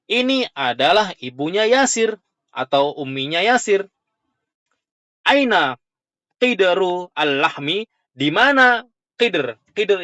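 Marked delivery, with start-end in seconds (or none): none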